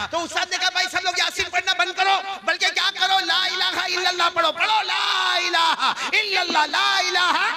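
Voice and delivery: very high, ringing exhortation; full-force preaching. Acted. Teacher ordering students to recite at deathbed, fervent. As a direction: urge the crowd into the chant.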